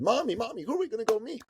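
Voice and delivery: high-pitched imitation of a small son; excited little boy. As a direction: pipe up like a kid pestering mommy. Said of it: "my little son said".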